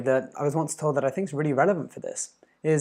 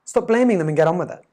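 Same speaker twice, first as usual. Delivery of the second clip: normal talking voice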